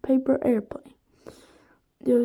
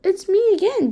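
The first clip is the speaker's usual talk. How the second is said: higher, louder singsong cook; cheery cook; 8 semitones higher, 5 dB louder, faster than usual. A narrator's reading. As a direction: chirp through the door. Cook calls through door.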